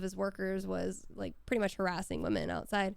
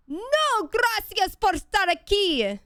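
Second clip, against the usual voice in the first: high, loud, slow furious yell; yelled at the top of her lungs; 11 semitones higher, 8 dB louder, slower than usual. Retold or imitated. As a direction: hurl the phrase at him across the street, drawn out. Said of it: "at the top of my lungs, I yelled".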